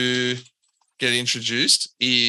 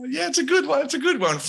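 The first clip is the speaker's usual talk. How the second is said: higher bubbly agreement; high tiefling voice, amused; cheerful tiefling; 13 semitones higher, faster than usual. Acted. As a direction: chirp it back, pleased with herself. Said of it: "She's like".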